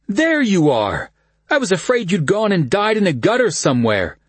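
high, loud, boisterous greeting; big cheerful shout of welcome; cousin Kyle voice. A narrator's reading.

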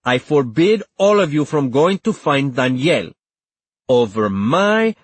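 unyielding command, slowing at the end; ironclad. A narrator's reading. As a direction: forbid it with no room for argument. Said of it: "Antonia's voice was ironclad".

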